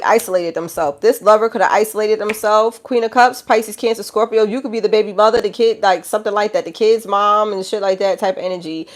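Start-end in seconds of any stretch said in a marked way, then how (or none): none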